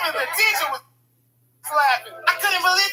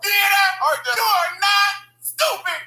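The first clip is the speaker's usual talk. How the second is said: forced announcement; 7 semitones higher than usual. Acted. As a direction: proclaim it to the whole class.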